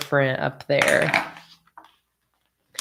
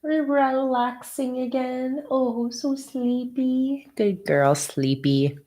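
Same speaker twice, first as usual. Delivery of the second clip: cooing to the dog; 6 semitones higher, slower than usual. Real, not acted. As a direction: lull the dog gently with sing-song praise.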